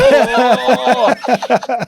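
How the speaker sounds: high loud pained oh; pained cry. Retold or imitated. Retelling waiting in pain, a high loud cry. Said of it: "I'm like".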